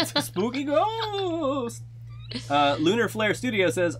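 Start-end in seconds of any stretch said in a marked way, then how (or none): 0.0-1.8 high, slow, wavering ghost voice; spooky ghost voice. Pretend. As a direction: moan it like a haunting ghost. Joking about a ghost.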